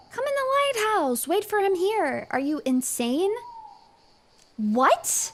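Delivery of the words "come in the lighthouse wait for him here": high mock voice. Mocking the dialogue option.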